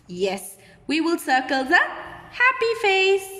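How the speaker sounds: normal talking voice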